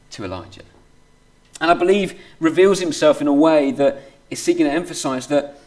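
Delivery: ordinary delivery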